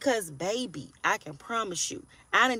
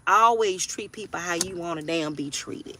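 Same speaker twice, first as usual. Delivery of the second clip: usual speaking voice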